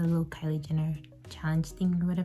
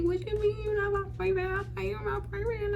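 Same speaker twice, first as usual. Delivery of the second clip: high, stunned disbelief; shocked; 13 semitones higher than usual. Retold or imitated. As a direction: squeak it out in shock. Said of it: "I was like".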